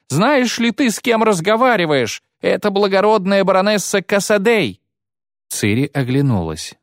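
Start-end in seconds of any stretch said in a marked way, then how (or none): 0.1-4.8 high indignant shrill cry; shrieking. Acted. Matron shrieking the baroness's title at Ciri. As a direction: scream her title at the robber. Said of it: "завопила Матрона".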